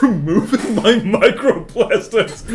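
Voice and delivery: high, loud, words breaking into laughter; real laughing. Real, not acted. Streamer jokes about bleeding out microplastics, cracking up. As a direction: crack up as you say it.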